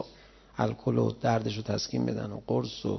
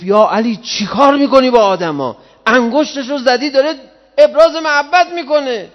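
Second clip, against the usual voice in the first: much higher and louder sneering yell; mocking enemy shout; 14 semitones higher, 13 dB louder than usual. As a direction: shout it as a scornful enemy taunting. Kharijite mocks shouting at Ali.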